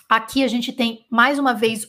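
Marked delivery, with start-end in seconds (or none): none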